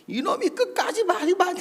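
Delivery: high-pitched scolding outburst; acted angry rebuke; scolding pastor. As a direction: tell off a mouthy junior, imitating the pastor. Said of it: "하면서 막 뭐라 하시다가".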